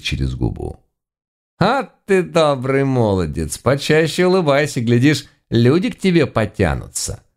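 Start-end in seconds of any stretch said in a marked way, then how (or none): 1.6-7.2 high-pitched Baba Yaga voice; teasing old granny. A narrator's reading. Baba Yaga to a haughty young servant, teasing. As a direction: play a chiding granny, pitched well up.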